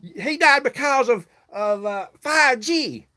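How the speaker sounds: high, dismissive mimic of the man; mocking the stranger. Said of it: "And then he".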